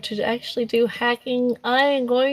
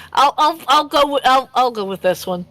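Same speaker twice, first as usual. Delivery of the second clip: ordinary delivery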